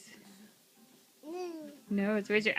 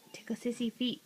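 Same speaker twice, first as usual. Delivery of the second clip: soft unvoiced whisper; playful whisper; 9 dB quieter, faster than usual. Real, not acted. Playful tickling game with baby.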